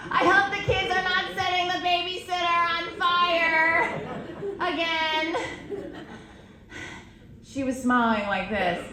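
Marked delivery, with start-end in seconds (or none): 0.1-5.4 higher, held through a forced smile; strained mom voice. Acted. Imitating a mom posing for a picture, strained cheer. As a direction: talk through a fixed, anxious smile. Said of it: "a mom smiles in a picture like this".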